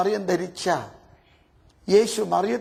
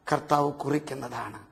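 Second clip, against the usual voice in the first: hushed; 7 semitones lower, 7 dB quieter than usual. Real, not acted. Preacher drops to soft.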